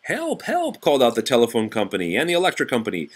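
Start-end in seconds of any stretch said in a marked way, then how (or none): 0.0-0.8 very high plea of help, help; calling for help. Acted. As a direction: squeak out a plea. Companies call for help.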